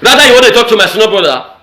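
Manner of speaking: normal talking voice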